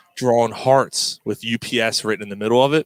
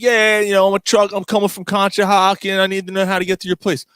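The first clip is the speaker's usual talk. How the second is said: higher-pitched trucker act; prank caller; 6 semitones higher than usual. Retold or imitated. Trucker prank calling the shipping desk for directions, put-on caller voice.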